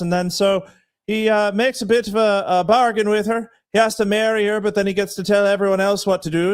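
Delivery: improvised Irish brogue for a quick recap; Irish accent. Shown as a demonstration. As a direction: tell it in a put-on Irish accent. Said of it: "in an Irish accent?".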